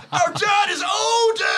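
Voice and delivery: high, loud, drawn-out bellow; hammed-up Thor. Imagining Brendan Fraser badly cast as Thor, hammed-up shouting. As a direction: shout it slowly as a hilariously bad superhero. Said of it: "him as Thor would have been hilariously bad".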